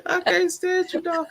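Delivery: squealing through laughter; laughing. Real, not acted. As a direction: laugh it out high. Laughing at a joke.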